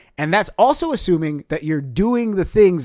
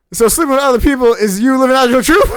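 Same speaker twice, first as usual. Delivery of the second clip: loud, higher incredulous retort; snapped; 9 semitones higher, 10 dB louder than usual. Retold or imitated. Telling off the cheating girlfriend, loud incredulous snap. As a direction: snap back in disbelief at her. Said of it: "And then I snapped".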